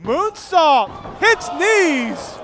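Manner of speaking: high, loud excited call; wrestling commentator. Real, not acted. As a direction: shout the big move out. Live wrestling play-by-play.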